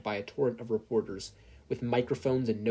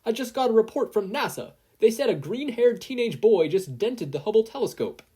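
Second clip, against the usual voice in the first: much higher, louder agitated secretary; breathless report; 11 semitones higher, 7 dB louder than usual. Acted. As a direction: rush the bad news out. Secretary reports alarming news.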